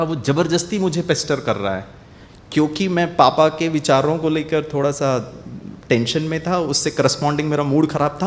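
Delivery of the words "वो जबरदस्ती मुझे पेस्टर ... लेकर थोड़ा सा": ordinary talk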